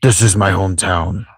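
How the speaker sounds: voiced Nanaki. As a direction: recite in an altered character timbre. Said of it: "I want it to be like".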